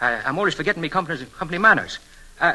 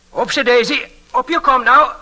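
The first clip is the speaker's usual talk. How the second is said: loud, high, cheery heave; hearty lifting call; 9 semitones higher, 8 dB louder than usual. Acted. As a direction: boost her up with a hearty effort call. Lifting the old lady onto bed.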